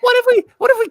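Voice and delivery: playful high-pitched echo; mock squeal. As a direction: squeal it back like an excited kid. Echoing co-host in mock squeal.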